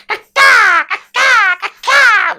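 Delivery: very high, loud screeched caws; bird call. Acted. Student attempting an eagle call, shrieked caws. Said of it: "Like an eagle call or something?".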